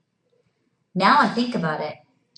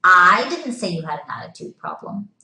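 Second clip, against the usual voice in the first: emphatic "I" at the start; stress on I; 4 dB louder than usual. Shown as a demonstration. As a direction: lean loudly on "I", let the rest fall away. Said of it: "emphasizing a different word".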